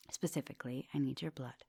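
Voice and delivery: low, soft whispered confession; menacing whisper. Acted. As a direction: let the hunger slip out in a whisper. Vampire reveals request to student.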